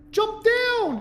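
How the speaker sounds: high-pitched urgent command; yelled order. Acted. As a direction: yell it high, ordering someone down. Repeats the order as a yell.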